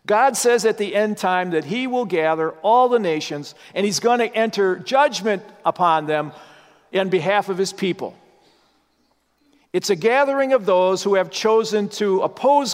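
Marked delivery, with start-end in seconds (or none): none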